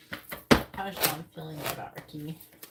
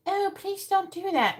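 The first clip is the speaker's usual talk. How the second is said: meek mock plea; 13 semitones higher, 5 dB louder, slower than usual. Mocking a meek person asking nicely, high timid plea. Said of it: "And if you're just like".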